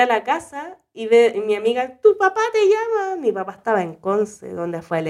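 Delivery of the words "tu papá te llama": high-pitched imitation of a friend; friend calling out. Friend announcing a phone call after the quake, high calling voice. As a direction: call it over in a raised, urgent voice.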